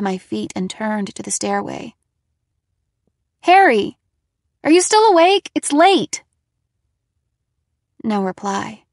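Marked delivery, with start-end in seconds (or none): 3.4-6.2 loud, higher call to upstairs; worried call. A narrator's reading. Calling to Harry upstairs, loud and worried. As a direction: call up the stairs, uneasy.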